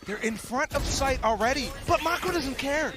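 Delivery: high, loud excited call; esports caster. Real, not acted. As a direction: punch the play with energy. Co-caster hype on the play.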